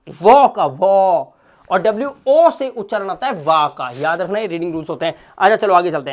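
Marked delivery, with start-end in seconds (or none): 0.1-1.3 वो का वो stretched very slowly and louder; drawn-out sound. Shown as a demonstration. Teacher drilling the वो sound for students, slow and stretched. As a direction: stretch each वो out so the sound is clear. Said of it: "उच्चारण आता है वो".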